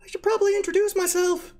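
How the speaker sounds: a tiny kobold whining at the stench; squeaky kobold. Acted. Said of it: "I'm Mister Smimbly Whiskers".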